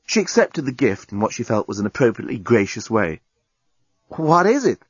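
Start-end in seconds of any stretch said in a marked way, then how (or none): 4.1-4.8 Lucy's voice. A narrator's reading. Lucy voiced.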